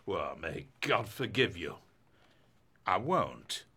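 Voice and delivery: ordinary talk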